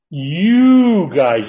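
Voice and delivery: "You" stretched long and loud; stressed YOU. Shown as a demonstration. Teacher showing the class the Greek shift, stressed "You". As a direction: punch "You", drawing it out high.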